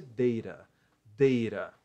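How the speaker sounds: deira with a tapped R for the T; American pronunciation. Shown as a demonstration. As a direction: say deira as an American would, DEI-ra. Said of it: "a pronúncia mais comum para um americano". (deira com pronúncia americana, som de R)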